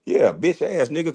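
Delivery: ordinary delivery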